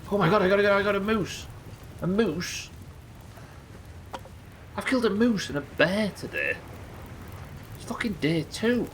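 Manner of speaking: high, excited disbelief; can't believe the kill, gush; thrilled. Real, not acted. Just killed a moose.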